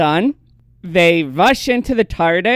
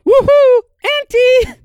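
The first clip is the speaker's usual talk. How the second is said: high, loud whooping call-out; mock excited call; 6 dB louder than usual. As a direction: whoop and holler as a decoy. Calling out to lure a Dalek.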